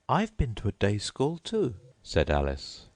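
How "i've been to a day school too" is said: put-on much higher polite girl voice; Alice's voice. A narrator's reading. Alice thanking the Mock Turtle, high and polite. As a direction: pitch up into a polite young girl.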